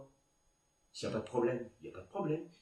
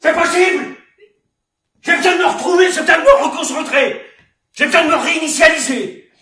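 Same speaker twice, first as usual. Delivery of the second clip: high, full-volume shouting rant; yelling, worked-up outburst; furious tirade; 8 semitones higher, 17 dB louder than usual. Acted. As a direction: rage at the whole cast. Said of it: "J'aime pas m'énerver".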